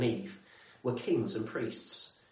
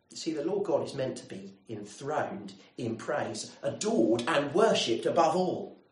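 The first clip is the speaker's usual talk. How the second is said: ordinary talk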